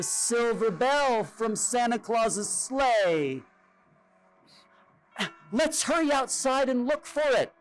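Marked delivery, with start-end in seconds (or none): 5.5-7.5 put-on higher, eager child's plea. A narrator's reading. A child begging to search for the lost bell. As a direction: urge the others outside. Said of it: "one of the children said".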